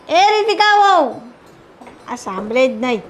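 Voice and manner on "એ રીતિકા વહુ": slow, long call across the house to the daughter-in-law; mother-in-law calling her daughter-in-law for tea, slow and drawn out. Acted. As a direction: stretch the name out to reach the kitchen.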